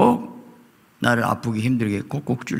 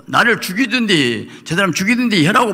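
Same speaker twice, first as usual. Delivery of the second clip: 하소연 흉내; 8 semitones higher than usual. Retold or imitated. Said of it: "얼마나 불렸고".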